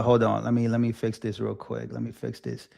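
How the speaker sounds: low, very soft, trailing away; absent-minded aside. Real, not acted. Streamer to himself while fixing something, quiet distracted aside. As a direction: fade down as if talking to yourself.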